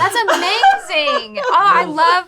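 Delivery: slow, high, loud words through laughter; laughing, moved. Real, not acted. Host moved by the nurse story, laughing and close to tears.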